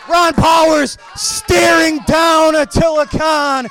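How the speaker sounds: wrestling announcer yelling over the chaos; excited play-by-play. Acted. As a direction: call the brawl like it's live and chaotic. Live play-by-play of ringside brawl.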